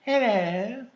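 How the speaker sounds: high, drawn-out proper hello; mock-proper answering-machine voice; proper phone voice. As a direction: imitate an overly proper phone greeting. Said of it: "it sounded all, like, proper".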